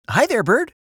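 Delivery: very high, chirpy rabbit greeting; high cartoon rabbit voice; friendly rabbit.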